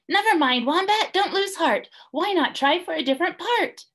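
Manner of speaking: high, gentle consoling voice; kind Bilby. A narrator's reading. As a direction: comfort a disappointed friend. Bilby comforts Wombat.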